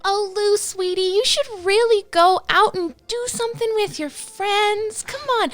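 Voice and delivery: high, sweet motherly coaxing; a doting mom nudging her homebody son to go out, high and sweet. Acted. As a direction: coo at her grown son like a fussing mother. GM voices Ma Tucker to son.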